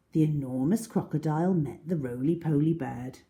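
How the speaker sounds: ordinary delivery, nothing marked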